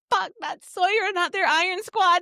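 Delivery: high anxious rush; panicked fan. Retold or imitated. Reader panicking over Sawyer, high and anxious. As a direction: fret over the character like a worried fan. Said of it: "Because I was like".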